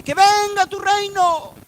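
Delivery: high, drawn-out proclamation of a prayer; emphatic proclamation. Acted. Preacher quoting the Lord's Prayer to the congregation, emphatic proclamation.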